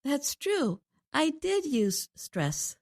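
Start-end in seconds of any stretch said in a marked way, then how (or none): 1.1-2.8 slow, "did" pushed higher and stressed. Shown as a demonstration. Co-host agrees with host about using stress, emphatic "did". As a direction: hit "did" and take it slowly.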